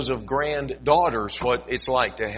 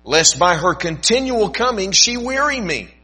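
slow, solemn Bible reading with swells; scripture reading; 3 semitones higher, 5 dB louder, slower than usual. Acted. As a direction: read the Gospel like a preacher, lingering on words. Pastor reads scripture aloud.

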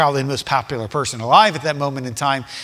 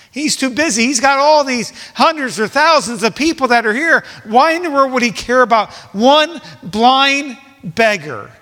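acted scorn; 6 semitones higher, 4 dB louder than usual. Crowd scorning the beggar, acted.

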